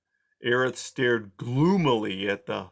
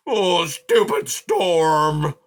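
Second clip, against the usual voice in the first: very slow, higher, louder shout; angry shout; 6 semitones higher, 8 dB louder, much slower than usual. A narrator's reading. Aerith shouting angrily at the storm, very slow. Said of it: "he shouted".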